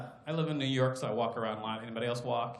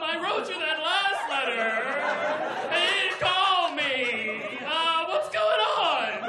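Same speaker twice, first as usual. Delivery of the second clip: higher, drawn-out pleading; needy Jesus; 5 semitones higher, slower than usual. Acted. Jesus in letter number two begging for a call, needy and insistent. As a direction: pester them with whiny, escalating insistence. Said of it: "Letter number two".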